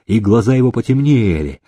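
usual speaking voice

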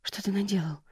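soft stunned question; shocked hush. A narrator's reading. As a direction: barely voice it in horror. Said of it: "выдохнула Джейн".

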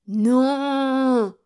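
loud, high, long-held cry; shouted refusal. A narrator's reading. Old miser refuses the priest, shouting. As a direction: bellow your refusal, stretching it out. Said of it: "cria Nikita".

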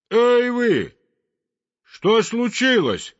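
high, loud call across the river; chimney sweep shouting over the noise. A narrator's reading. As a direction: call out to the birds. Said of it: "крикнул трубочист".

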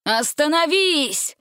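high, slow alarmed cry; shout. A narrator's reading. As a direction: order him to stop. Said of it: "закричал король".

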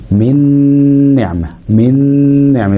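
من نعمة as one word with a long nasal n; leaning on noon. Shown as a demonstration. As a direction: press and hold the n between من and نعمة. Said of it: "اتك على النون شوية".

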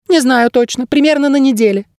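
very high little bird; squeaky bird guide. A narrator's reading. Bird guide guessing when attack comes, high squeak. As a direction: answer in a thin squeak. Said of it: "сказал Птах".

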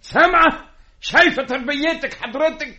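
high-pitched indignant outburst; angry reproach. Acted. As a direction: throw the blame at your wife. Husband blames wife for son. (بعتاب غاضب وصوت عالي)

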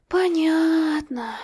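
put-on high, slow, resigned little girl; a small child giving in with a sigh. A narrator's reading. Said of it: "тяжёло вздохнула".

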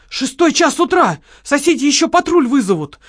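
high, loud urgent rebuke; loud worried scolding; alarmed scolding. Acted. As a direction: hush him down sharply. Scolding shouting partner.

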